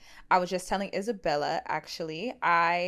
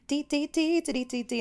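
high sing-song dee; 8 semitones higher than usual. Retold or imitated. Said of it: "one of us is like".

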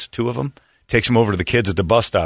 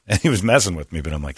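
usual speaking voice

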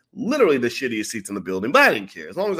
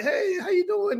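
friendly greeting; slower than usual. Retold or imitated. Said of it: "And I'm like".

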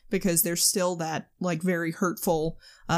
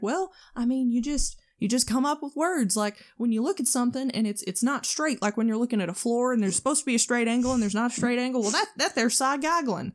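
higher, imitated Southern mountain accent; mountain old-timer; 4 semitones higher than usual. Old-timer explaining his word to film crew, imitated Southern accent. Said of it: "this one guy that's like".

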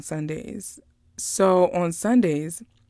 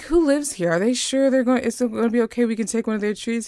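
higher-pitched imitation of anxious questions; concerned questioning; 5 semitones higher, 3 dB louder, faster than usual. Woman unsure about taking someone's tree, retold high and concerned. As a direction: run the questions together, lightly worried.

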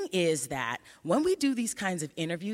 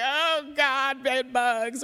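high, slow, drawn-out panic; a hammed-up "Oh, God, bed bugs"; mock panic; 7 semitones higher, 4 dB louder, much slower than usual. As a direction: pretend to freak out over bugs. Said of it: "jump and scream and yell".

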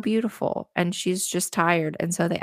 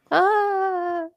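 mock scream; 14 semitones higher than usual. Fake scare from faces.

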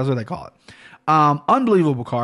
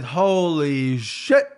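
slow, stretched-out holy shit; drawn-out awe; much slower than usual. Real, not acted. Podcaster reacting to each fight on the card, slow and awed. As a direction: let it out in slow amazement. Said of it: "I can't keep doing that".

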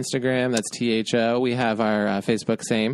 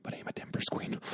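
whispered punchline; 14 dB quieter, faster than usual. Pretend.

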